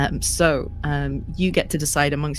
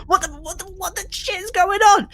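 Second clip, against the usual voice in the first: high-pitched, stammering outburst; alarmed band manager; 5 dB louder, faster than usual. Acted. Band manager in the green room reacts, high alarmed outburst. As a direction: build from sputtering disbelief to a loud, high cry.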